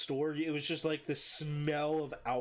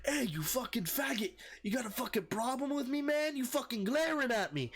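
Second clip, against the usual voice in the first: high, heated imitation of a belligerent drunk; pitched-up confrontational yelling, imitated; drunken aggression; 10 semitones higher than usual. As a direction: pick a fight in a mock drunk voice. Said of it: "slams his hand in front of me and goes".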